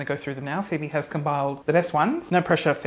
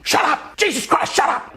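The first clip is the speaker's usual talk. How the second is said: dad scolding; 8 semitones higher than usual. Retold or imitated. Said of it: "Dad would be".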